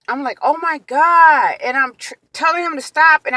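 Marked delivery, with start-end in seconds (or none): 0.4-1.6 loud, high, drawn-out exclamation; stretched-out, appalled retold cry; shocked outcry. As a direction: re-enact a pained shock. Acts out her shock in the chair.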